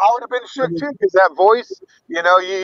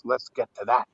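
a lightly done impression, low and quiet, a bit sinister; creepy little voice; 7 semitones lower, 10 dB quieter than usual. Joking with his cohost, a quiet low creepy impression. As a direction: lower and much softer, a hint of something creepy. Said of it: "What's this creepy little voice you're doing".

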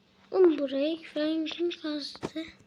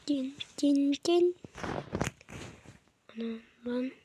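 lightly raised, put-on girlish voice. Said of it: "beş dakikadır kız sesi yapıyordum". (hafifçe inceltilmiş kız sesi taklidi)

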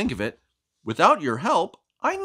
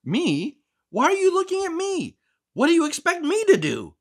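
fussy book character. Acted. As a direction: scold the reader for snacking. Character as frog.

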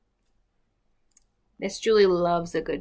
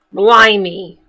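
usual speaking voice

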